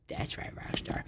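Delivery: barely audible whispered echo. Pretend. Podcaster echoing his own answer to Rockstar, whispered. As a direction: repeat it under your breath, voiceless.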